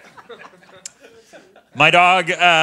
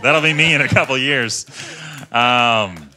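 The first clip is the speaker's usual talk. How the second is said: ordinary talk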